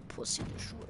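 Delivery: unvoiced hushed the Pussy Destroyer; spooky whispered name; scary whisper. Shown as a demonstration. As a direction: hiss it like a menace. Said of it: "that sounds pretty scary".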